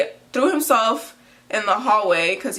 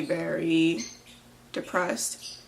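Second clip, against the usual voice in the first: usual speaking voice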